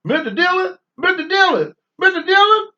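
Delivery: high, loud, nasal-free sidekick whine calling the marshal; Chester from Gunsmoke. Retold or imitated. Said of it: "sound like Chester on Gunsmoke".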